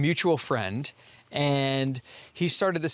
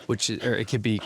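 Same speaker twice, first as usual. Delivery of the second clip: usual speaking voice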